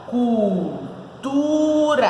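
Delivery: Cultura drawn out very slowly, syllable by syllable: cul, tu, ra; split into syllables. Shown as a demonstration. Teacher showing the class how to separate it, Cultura in slow syllables. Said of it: "como que a gente separa?".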